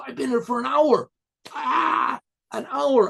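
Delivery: suddenly remember the king and panic; alarmed realization. Acted. Rabbi realizes he is late.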